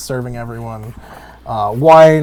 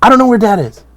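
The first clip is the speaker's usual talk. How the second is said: high, louder defensive answer; Cassandra's reply; 10 semitones higher, 7 dB louder, faster than usual. Acted. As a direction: snap back, high and stung. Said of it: "you see her just go".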